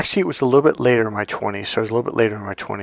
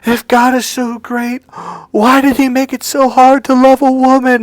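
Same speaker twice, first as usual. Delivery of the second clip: high, loud mock-anguished wail; reenacted sobbing lament, pitched way up; imitating tearful outcry; 13 semitones higher, 6 dB louder than usual. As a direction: cry out like a man bursting into tears. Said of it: "he bursts out in tears, and he cries out".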